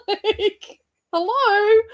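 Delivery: high, laughing mock hello. Real, not acted. Laughing at her husband's oblivious shark question, high pitched hello. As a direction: laugh through a pointed hello.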